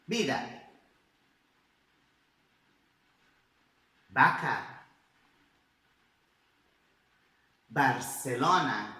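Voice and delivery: "Vida" and "Vaca" said with B, then a pause for the class to repeat; "Barcelona" said slowly, with a Spanish B. Shown as a demonstration. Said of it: "Agora vocês comigo".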